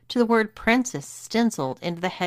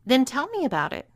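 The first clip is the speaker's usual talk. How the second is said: higher-pitched, mildly commanding; ordering; 7 semitones higher than usual. Acted. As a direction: give the order with pitch lifted. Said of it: "ordered Lily".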